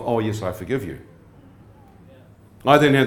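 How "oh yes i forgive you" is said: mumbled off quickly, softer and lower, just to keep everyone happy. Shown as a demonstration. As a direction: toss "Oh, yes, I forgive you" off as a soft mumble. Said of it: "mumbling off".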